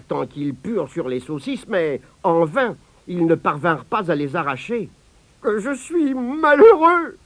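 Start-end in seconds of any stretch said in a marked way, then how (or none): 5.4-7.2 high, slow wail; husband crying over sausages on his nose; weeping. A narrator's reading. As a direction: lament with tears in the voice. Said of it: "pleurait-il".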